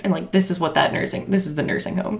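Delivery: normal talking voice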